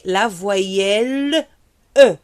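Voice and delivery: slow, careful "la voyelle E" for repeating; model vowel E. Shown as a demonstration. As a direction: stretch "la voyelle E" so pupils copy it. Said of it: "Il faut bien prononcer la voyelle E".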